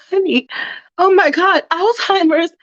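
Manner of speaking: shocked daughter. Acted.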